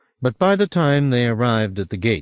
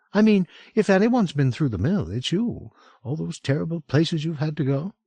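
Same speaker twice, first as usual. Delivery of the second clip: higher woman's voice, warmly sympathetic; Franny, sympathetic; 8 semitones higher than usual. A narrator's reading. Franny comforts her old friend, higher sympathetic woman. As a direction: soften toward him as you say it.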